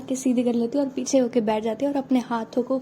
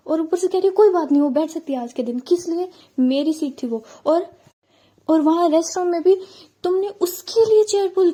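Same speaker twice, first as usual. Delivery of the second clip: rising, louder, fast jealous rant; nonstop angry rant; 4 semitones higher, 4 dB louder than usual. Acted. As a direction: pour out the complaints without stopping. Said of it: "non-stop बोलने लग जाती है मेघा".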